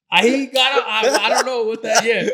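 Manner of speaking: laughing. Real, not acted. Laughing at the absurd moment.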